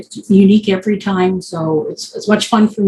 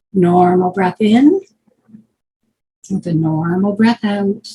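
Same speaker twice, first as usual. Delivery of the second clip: slow, stretched words with long pauses; guided breathing; slower than usual. Real, not acted. Guide bringing group back to normal breaths, slow guided breathing. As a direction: pace each breath slowly for the listener.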